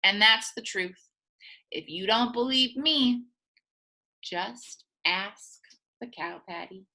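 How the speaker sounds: ordinary delivery, nothing marked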